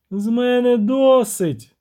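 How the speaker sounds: high, slow lilting siren cry; singsong shout. Acted. Siren has had enough of waiting, singsong cry. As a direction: draw it out high as a melodic exclamation. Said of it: "співуче прокричала Шейнас".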